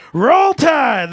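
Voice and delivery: big high chant shout; shouted Roll Tide. Real, not acted. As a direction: roar the team cheer. Comic yells team chant.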